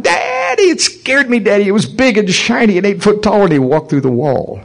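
high, frightened squeaky daughter; little mouse voice. Retold or imitated. Daughter telling Daddy about the angel, imitated mouse voice. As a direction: imitate a scared girl squealing to her dad. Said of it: "her voice sounded like a little mouse".

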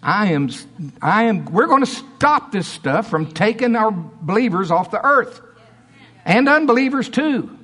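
high, indignant preaching; mad. Real, not acted. Mad preacher vowing to stop believers dying of sickness, angry preaching. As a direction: preach it with real anger, pitch climbing. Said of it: "I'm still mad as hell".

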